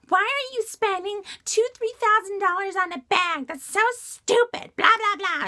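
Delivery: high, whiny put-on voice; mocking coworker. Mimicking the coworker who mocks her expensive bag, high and whiny. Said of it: "she was like".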